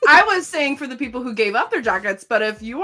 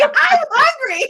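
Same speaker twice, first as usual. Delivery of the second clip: put-on kid voice; 6 dB louder than usual. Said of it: "since we're doing performing for kids today".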